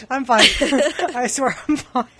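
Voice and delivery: insisting through bursts of laughter; real giggles. Real, not acted. Co-host told to get control, giggling. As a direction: claim composure while still laughing. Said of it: "Okay, get control".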